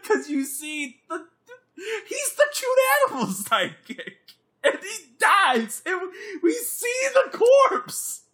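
very high, squealing protest; laughing outrage. Real, not acted. Why the movie skipped theaters, squealed laughing outrage. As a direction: wail it in laughing disbelief.

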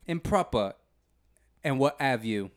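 put-on improper British accent; not-so-proper British voice; street British accent. Shown as a demonstration. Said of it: "the one I do is more like".

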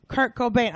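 quick-fire "Cobain", ending like "bane"; singer's name, Cobain. Pretend. Clerk's last word in the mix-up, "Cobain" like bane. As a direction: counter with "Cobain", rhyming with rain. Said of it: "musical version of Who's on First".

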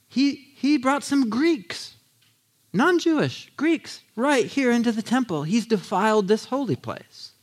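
a touch of high, slow outrage; outraged accusation. Retold or imitated. Angry crowd accusing Paul of defiling temple, high outrage. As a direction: spit out the charge, mildly hammed-up. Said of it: "they say".

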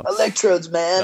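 spaced-out stoner drawl; laid-back hippie, man. Shown as a demonstration. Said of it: "doing in my hippie voice".

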